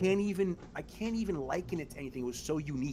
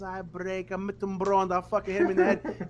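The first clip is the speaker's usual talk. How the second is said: higher, louder mock Italian grandma with a laugh; grandma's accented threat; 8 semitones higher, 8 dB louder than usual. Great-grandma answering the mother, mock accented threat. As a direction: threaten like a grumpy old nonna. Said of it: "And she goes".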